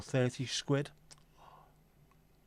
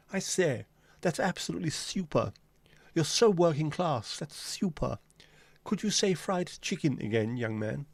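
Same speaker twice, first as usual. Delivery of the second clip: higher-pitched, condescending posh English toff; posh English accent; 4 semitones higher than usual. Acted. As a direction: talk down in a hammed-up plummy English accent. Parodying posh people who say super.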